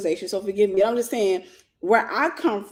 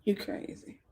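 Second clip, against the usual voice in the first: breathy whispered tease; 11 dB quieter, slower than usual. Retold or imitated. Perv whispering to her, seductive whisper.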